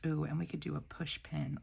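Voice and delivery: ordinary talk, nothing marked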